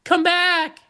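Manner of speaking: squeaky falsetto plea; high-pitched come back; high falsetto call. Real, not acted. Calling after a leaving coworker.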